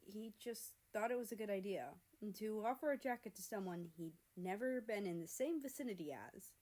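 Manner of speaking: plain reading voice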